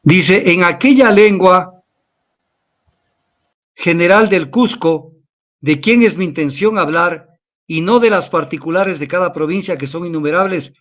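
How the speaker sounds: put-on slow, measured reading of an old text; reading aloud from a book. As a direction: read the old chronicle to the audience. (lectura pausada de un libro antiguo)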